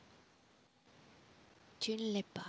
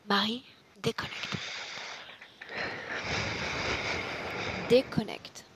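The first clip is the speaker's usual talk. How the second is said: loud, abrupt order; firm command; 6 dB louder than usual. Real, not acted. Practitioner ordering an assistant to disconnect, firm command. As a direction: give the order with force.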